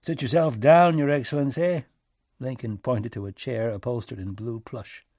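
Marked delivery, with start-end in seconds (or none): 0.0-1.9 louder, higher folksy host; rustic American president. Acted. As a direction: offer a seat in a country accent. Lincoln's rustic voice in story.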